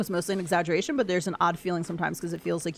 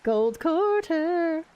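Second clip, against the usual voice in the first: high, very slow stretched-out words; drawn-out wish; 10 semitones higher, much slower than usual. Pretend. Streamer begging the game for a gold quarter, high and drawn out. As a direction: croon it like a longing wish.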